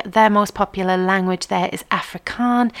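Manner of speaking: normal talking voice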